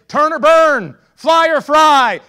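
high, booming mock-preacher shout; mock fire-and-brimstone. Preacher parodying what Jesus didn't say, high mock shout. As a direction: blast it out like a hellfire threat. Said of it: "Notice Jesus didn't say".

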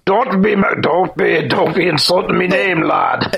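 mock-offended Scottish brogue; Scottish accent. Host defends his name in a light Scottish accent. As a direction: play the insulted Scot. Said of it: "let me get my Scottish accent".

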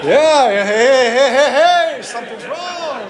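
loud startled hey hey, high and laughing; startled shout. Retold or imitated. Pastor startled at being beckoned, loud alarmed laughing cry. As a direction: jump back in alarm, laughing.

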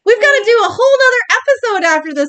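high, loud, giggly wail; laughing exasperation. Real, not acted. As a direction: complain helplessly through laughter. Flustered after repeated stumbles, both laughing.

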